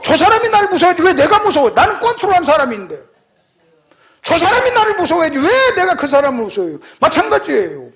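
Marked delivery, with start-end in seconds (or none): 0.0-6.8 high-pitched, emphatic mock indignation; defiant retort. Preacher boasting he fears no stranger, defiant retort. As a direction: push it high and incredulous, as acted defiance. Acting out his fearless retort.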